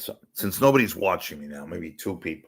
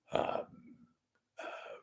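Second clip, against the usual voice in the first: ordinary talk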